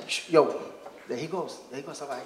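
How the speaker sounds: a soldier spotting the target, low and hushed. Acted. A soldier spotting bin Laden, low and hushed.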